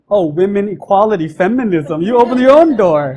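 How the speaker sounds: loud sarcastic brush-off; mock her with feminism; hammed-up sarcasm; sarcastic retort. Mocking reply.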